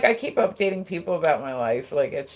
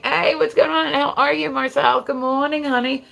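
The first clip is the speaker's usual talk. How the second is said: high, loud, cheerful hello; excited greeting; 9 semitones higher, 5 dB louder than usual. Real, not acted. Streamer welcoming a viewer to the live, excited greeting. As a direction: greet an arrival with raised pitch and energy.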